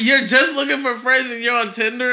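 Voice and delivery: high, loud, incredulous; can't believe what you heard; shocked disbelief. Real, not acted. Disbelief at friend.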